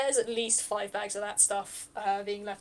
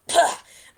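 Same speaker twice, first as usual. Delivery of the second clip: loud spitting out; 7 semitones higher, 14 dB louder than usual. Acted. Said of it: "Eenie just spits the burlap sack".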